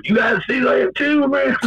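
very high, frantic mock call; imitated stranger's call. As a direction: pipe up like a freaked-out voice from afar. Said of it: "they hear some voice go".

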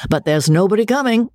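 high, teasing mock call; joking. A narrator's reading. George teasing Amelia on entering, high playful mock announcement. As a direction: tease like a merry young officer. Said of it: "Enjoying the joke hugely".

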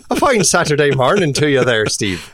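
high, loud, hammy Irish greeting; bad Irish accent. Host greeting co-host Steve in a loud, bad Irish accent. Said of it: "bad accent Saturday matinee".

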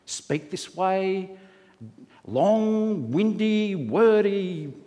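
slow, drawn-out imitation of church praying; old-fashioned prayer voice. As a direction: stretch each word like a long-winded pray-er. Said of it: "the King James Version accent when they prayed".

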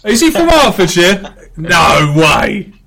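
loud, high burst of real surprise; can't believe what he's just heard; a genuine loud "no way"; shocked disbelief. Real, not acted. Learns guest is from Hertfordshire.

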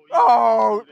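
loud high distressed cry. Real, not acted. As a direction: cry out, half laughing in panic. Panicking in the car.

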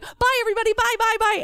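high-pitched chirped farewell; nervous hasty goodbye. Acted. Child speed-walking out of the visit, nervous goodbye. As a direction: toss off goodbyes while fleeing.

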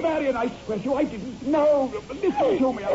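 desperate pleading. Acted. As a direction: beg her to believe you. Husband pleads with frightened wife.

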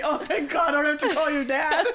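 high quote through laughter; mock relief bubbling with laughter; laughing relief. Quoting friend's relieved words, laughing.

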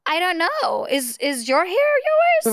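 an exaggerated playing-dumb question back. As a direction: feign naive confusion, drawn out. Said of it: "sometimes I just play dumb".

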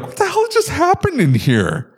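high-pitched mock outrage; appalled disbelief. Imitating whoever walks into the messy bathroom, appalled. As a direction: exclaim it high, acting out disbelief.

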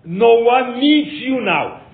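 high, loud, slow put-down; exasperated rebuff. Acted. Rebuffing Abraham who insists on guests, loudly exasperated.

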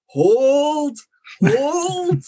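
long, high-pitched drawn-out holler; lone fan's shout. Retold or imitated. Fan mimicking a lone supporter urging the team, high imitated holler. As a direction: imitate one voice calling out over the crowd. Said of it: "a guy at the football with this fantastic voice".

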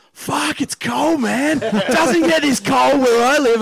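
high, slow, loud drawn-out moan about the cold; Aussie bloke. Retold or imitated. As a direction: ham up a freezing Aussie backpacker. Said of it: "he goes".

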